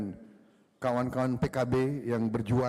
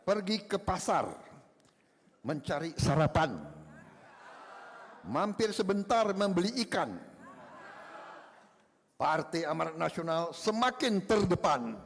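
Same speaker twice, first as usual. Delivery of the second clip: sing-song rhyming verse, higher; reciting a pantun; 4 semitones higher than usual. Real, not acted. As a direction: recite it like a playful folk rhyme. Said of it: "dengan pantun".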